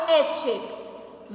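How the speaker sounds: ऐच्छिक drawn out slowly and softly; slow demo word. Shown as a demonstration. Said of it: "तो क्या बन गया".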